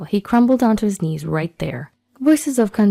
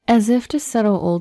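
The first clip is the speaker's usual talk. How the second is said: ordinary delivery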